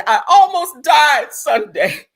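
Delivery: loud, high, drawn-out melodrama. Retold or imitated. Reading a singer's post to viewers, overdramatic wail. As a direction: wail it slowly, hammed up. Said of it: "I gotta read it in her voice".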